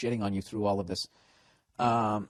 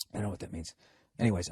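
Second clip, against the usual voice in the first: ordinary delivery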